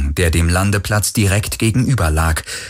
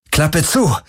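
higher, urgent call; called order; 7 semitones higher than usual. Acted. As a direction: shout to close up. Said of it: "rief er".

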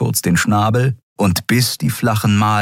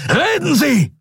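high-pitched, urgent bellow; roared command. A narrator's reading. Admiral demanding that his prisoner talk, high roar. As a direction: roar it as a sudden order. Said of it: "brüllte Telios".

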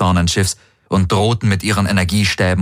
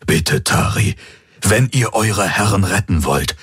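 dark-timbred man, urgent appeal; Hawks, earnest pleader; 4 semitones higher than usual. A narrator's reading. Hawks urging Tari to surrender, earnest plea. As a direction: plead earnestly with the enemy guard.

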